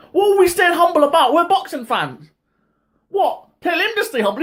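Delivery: rising outrage, voice climbing high; indignant rant. Real, not acted. Boxing fan scoffing at the humble slogan, high-pitched indignation.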